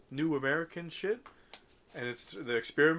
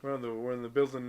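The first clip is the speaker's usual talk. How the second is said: normal talking voice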